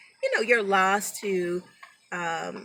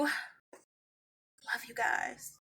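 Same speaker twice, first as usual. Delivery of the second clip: slow, softer, breathy through tears; choked-up goodbye; tearful whisper; 3 semitones higher, 11 dB quieter, much slower than usual. Real, not acted. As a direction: whisper it, too choked to speak.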